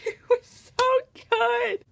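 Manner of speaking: high, drawn-out squeal after a laugh; gushing. Real, not acted. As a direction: squeal it slowly with delight. Laughing, gushing over the scene.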